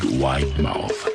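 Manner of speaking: plain reading voice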